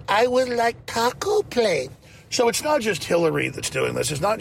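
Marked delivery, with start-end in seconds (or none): 0.1-1.9 high, slow talking-down voice; stretched-out, mocking over-enunciation; condescending slow English. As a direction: order as if to someone who barely understands. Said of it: "There is a real condescension in liberals".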